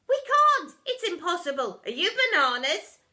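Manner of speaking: high, loud outcry; the crowd shouting back; protest. A narrator's reading. Pupils shouting at the deputy, loud and high.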